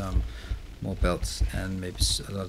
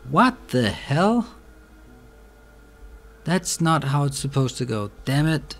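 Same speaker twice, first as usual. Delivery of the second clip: higher, louder real frustration; exasperated disbelief; 4 semitones higher, 5 dB louder than usual. Real, not acted. Player cursing belts that misbehave, exasperated disbelief. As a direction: protest loudly in disbelief. Game belts fail to work.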